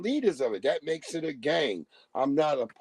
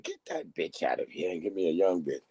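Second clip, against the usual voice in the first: lowered mock king voice; 10 semitones lower, 3 dB quieter than usual.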